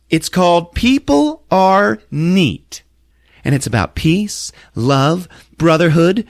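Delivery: high, slow, loud character proclamation; grand show pitch. Retold or imitated. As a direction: announce each ideal grandly in a high put-on voice. Opal pitches her musical.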